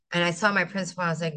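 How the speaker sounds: ordinary delivery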